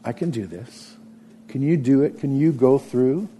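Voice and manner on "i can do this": softer "I can do this." with a gentle rise; humble trust in "I can do this."; quiet sincere faith. Shown as a demonstration.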